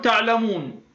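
تعلمون with the waw held just two counts; two-count madd. Shown as a demonstration. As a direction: keep the long u of تعلمون short and natural. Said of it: "هذه كم؟ حركتان".

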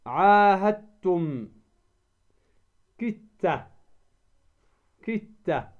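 عاهدتم as 'ahattum, كدت as kitta; dal merged into ta. Shown as a demonstration. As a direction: double the t and drop the d in عاهدتم, كدت. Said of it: "فتدغم الدال في التاء".

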